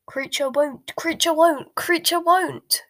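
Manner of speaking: stubborn little elf croak, rising with each repeat; croaking house elf. A narrator's reading. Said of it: "croaked the house elf, quite as loudly".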